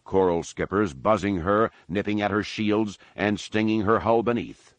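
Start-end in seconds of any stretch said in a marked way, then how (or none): none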